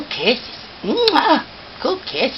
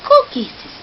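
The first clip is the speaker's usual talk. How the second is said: high, louder squeal of praise; 13 semitones higher, 4 dB louder than usual. Real, not acted. High loud cooing praise.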